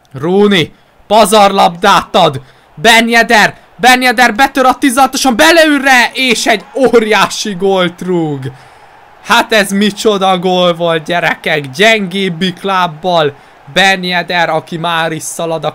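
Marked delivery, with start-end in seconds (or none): 0.1-8.5 excited Hungarian sports commentary. Real, not acted. FIFA career goal commentary. 9.2-14.5 excited. Real, not acted. Celebrating the goal.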